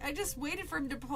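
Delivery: ordinary delivery, nothing marked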